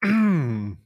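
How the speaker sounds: dry hacking cough; coughing fit. Real, not acted. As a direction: cough to clear the throat. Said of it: "wir räuspern uns alle mal kurz".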